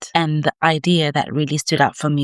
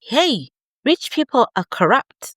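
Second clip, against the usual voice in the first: mock parent repeating money warnings, higher; nagging parent; 10 semitones higher than usual. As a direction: mimic a lecturing mom, pitched up. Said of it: "If you grew up hearing things like".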